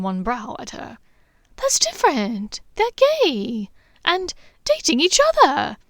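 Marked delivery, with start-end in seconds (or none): 1.6-5.8 rising, louder indignant laugh; higher, louder laughing objection; laughing protest. A narrator's reading. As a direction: protest through giggles, climbing. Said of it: "Hermione protested, laughingly".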